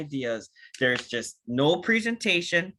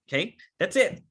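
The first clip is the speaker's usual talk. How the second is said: ordinary delivery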